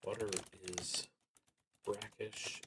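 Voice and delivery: ordinary talk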